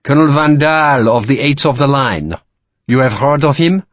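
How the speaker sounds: put-on courteous French-accented captain; French officer. French captain to Sharp, polite French accent. Lacroix voiced.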